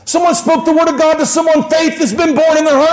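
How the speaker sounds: high, excited preacher's cry; fervent preaching. Acted. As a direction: proclaim the news to the whole room. Preaches at full pitch.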